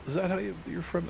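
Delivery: can't believe the town he just named; astonished. Retold or imitated.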